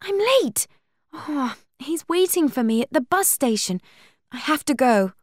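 high, urgent realisation of being late; flustered alarm. A narrator's reading. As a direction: blurt it out high, needing to leave. Buffy remembers she is late.

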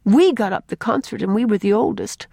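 mild remorse, emphatic; remorseful. A narrator's reading. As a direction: take the blame like a sorry girl. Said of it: "said Faith remorsefully".

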